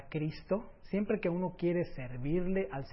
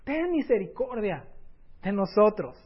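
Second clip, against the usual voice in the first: higher, louder pleading cry; desperate cry; 5 semitones higher, 8 dB louder than usual. Acted. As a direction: plead as a blind man shouting for mercy. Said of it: "imagínense los gritos de desesperación".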